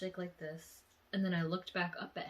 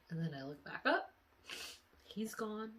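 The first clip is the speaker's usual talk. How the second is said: normal talking voice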